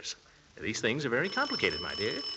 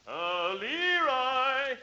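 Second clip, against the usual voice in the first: calling upstairs; 12 semitones higher, 4 dB quieter, much slower than usual. Acted. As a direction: shout his name so it carries upstairs. Uncle calls nephew from downstairs.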